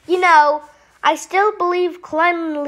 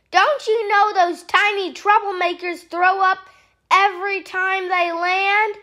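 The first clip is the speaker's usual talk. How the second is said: ordinary delivery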